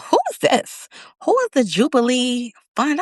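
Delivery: high, startled who is this; a mock baffled squeak at the letter; puzzled surprise.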